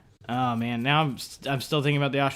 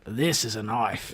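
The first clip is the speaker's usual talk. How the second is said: slow, lower Australian accent; 6 semitones lower, 5 dB quieter, slower than usual. Shown as a demonstration. Player finishing a movie quote, slow low Australian accent. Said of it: "Australian accent".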